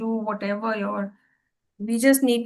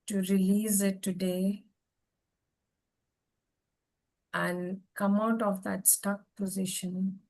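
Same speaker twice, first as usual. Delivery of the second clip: guided meditation. Acted. Leads a breathing meditation.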